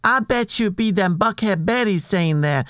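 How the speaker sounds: lightly put-on Southern accent. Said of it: "changing your accent".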